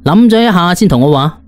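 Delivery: usual speaking voice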